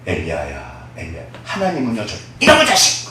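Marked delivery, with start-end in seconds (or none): 0.1-1.2 low, soft, tender call; gentle whisper. Acted. God gently calling Elijah in the cave, low and soft. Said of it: "아주 작은데 너무 부드러운 소리예요". 2.4-3.1 high, sharp scolding yell; mock scolding. God as he does not speak, scolding Elijah in mock anger. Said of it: "그러지 않으세요".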